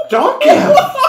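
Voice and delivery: very high, loud laughing squeal; delighted squeal. Acted.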